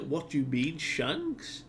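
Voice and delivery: mock surprise, squeaking up at the end; startled question. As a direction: pitch leaping up on the last word. Luffy startled by hearing Shanks's name.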